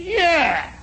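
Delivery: high, loud acted "yeah"; defiant retort. Sergeant answering private's challenge, loud and defiant. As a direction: throw it back as a challenge.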